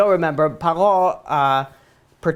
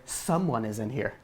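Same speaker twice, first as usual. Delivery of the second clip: discreet third person; 8 semitones lower, 11 dB quieter than usual. Shown as a demonstration. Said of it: "refer to themselves in third person".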